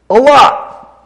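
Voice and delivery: high, emphatic little add-on; psalmist's afterthought. Retold or imitated. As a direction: tack it on with a sudden realizing lift. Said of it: "And then he goes".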